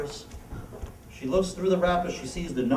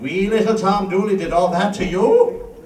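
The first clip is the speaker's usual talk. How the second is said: Irish bartender; 3 semitones higher, 8 dB louder than usual. Acted.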